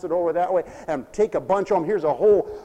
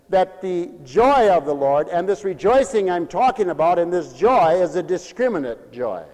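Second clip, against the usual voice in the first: ordinary talk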